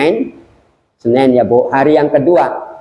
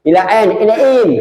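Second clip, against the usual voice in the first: imitating Saudis; 7 semitones higher, much faster than usual. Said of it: "Ini orang sana".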